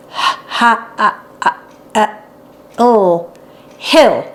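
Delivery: sounding out hill letter by letter, breathy H sounds then oh, then hill; child sounding out a word. A narrator's reading. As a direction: sound out "Ha, ha, ah, ah, ah, oh, hill" slowly like a child learning to read. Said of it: "Hart said one evening".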